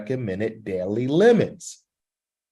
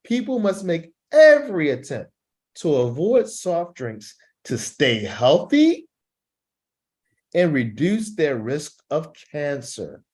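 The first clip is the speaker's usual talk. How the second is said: starts high with "every" stressed hard, then slows down on the rest; 3 semitones higher than usual. Shown as a demonstration. Teacher demonstrates emphasis by moving the voice up and down. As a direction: swing the pitch to push the point home. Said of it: "You see how my voice goes up and down".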